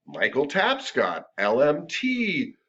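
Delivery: slow, deliberate spelling out of "Michael Tapscott, L M T"; over-the-top card reading. Retold or imitated. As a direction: read "Michael Tapscott, L M T" slowly, each letter separately, like a proud title. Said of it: "Some massage therapists are like".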